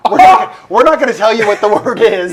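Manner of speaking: high loud giggly teasing; laughing tease. Real, not acted. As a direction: tease chat while laughing.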